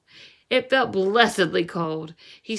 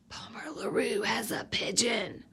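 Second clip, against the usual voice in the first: soft unvoiced rumour whisper; gossiping whisper; 7 dB quieter than usual. Rumour spreading around town.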